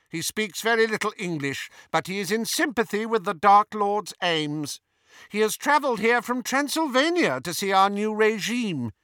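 high, haughty witch voice; Bellatrix. A narrator's reading. Disguised Hermione vouching for Ron to Travers, haughty. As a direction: explain him in a lofty, high-pitched voice.

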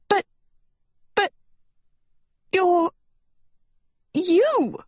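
high, halting stammer of disbelief; stunned cry. A narrator's reading. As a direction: splutter it out in pieces, pitched well up. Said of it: "Sophie cried".

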